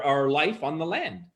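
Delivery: ordinary delivery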